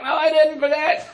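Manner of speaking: high, carrying call back; yelling back. Retold or imitated. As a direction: shout the answer over your shoulder. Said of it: "he yells back".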